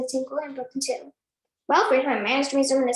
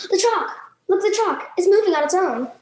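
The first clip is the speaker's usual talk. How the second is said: higher, loud panicked shout; screaming; 5 semitones higher, 3 dB louder than usual. Acted. Nigel shouts to the classroom about the moving chalk, panicked yell. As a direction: yell it, pointing at the board in alarm.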